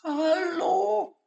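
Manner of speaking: very high small plush-toy voice; high toy penguin voice; tiny voice. Acted. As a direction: squeak it out like a little stuffed animal. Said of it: "zu hören war".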